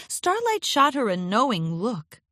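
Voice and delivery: ordinary delivery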